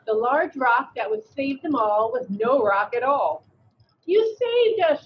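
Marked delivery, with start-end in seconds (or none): 4.0-5.0 high, slow grateful shout. A narrator's reading. Frogs thanking the toad who saved them, high shout. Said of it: "shouted the frogs".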